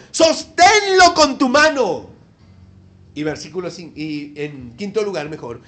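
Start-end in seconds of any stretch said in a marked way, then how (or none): 0.1-2.1 emphatic proclamation. Acted. Emphatic quote of God.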